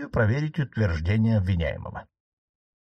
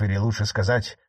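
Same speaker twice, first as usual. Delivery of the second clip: ordinary delivery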